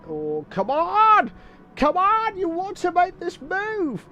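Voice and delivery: high, loud drawn-out urging shout; real shouting. Real, not acted. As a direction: yell at the driver to make his move. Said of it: "He's probably heard me shouting".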